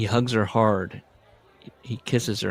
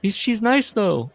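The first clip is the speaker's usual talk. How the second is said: mock surprise; 11 semitones higher, 4 dB louder than usual.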